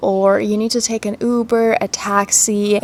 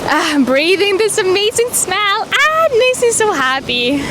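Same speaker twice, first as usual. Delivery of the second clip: high, delighted sighs; blissful; 10 semitones higher than usual. Real, not acted. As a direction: savor the sea air happily. Blissful at the ocean.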